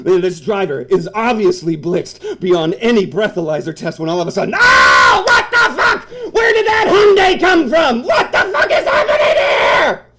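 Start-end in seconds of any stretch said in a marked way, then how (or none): 4.6-10.1 high, loud stunned shouts; screaming at the crash; shocked yelling. Acted. Second car crash appears.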